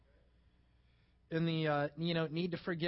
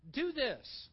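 ordinary delivery

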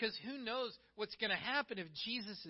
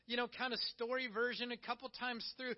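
ordinary talk

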